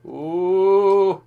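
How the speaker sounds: high, long drawn-out "ooh"; reacting "ooh". Real, not acted. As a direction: let out a rising, held "ooh" at the play. Reacting to a play.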